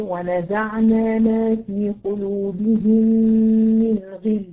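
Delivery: slow recitation of the verse. Shown as a demonstration. The teacher recites the verse for her student. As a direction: recite it calmly and slowly. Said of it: "ما تجيش بقى عند كلمة غل".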